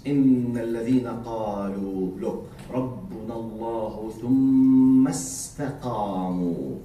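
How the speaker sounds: low, melodic Quranic recitation. Acted. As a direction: recite the verse with a measured chant. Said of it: "Let's enjoy listening to this".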